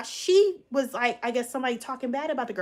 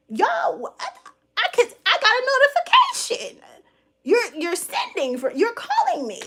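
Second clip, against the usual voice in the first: worked-up; 10 semitones higher, 5 dB louder than usual. Real, not acted. Worked-up rant climax.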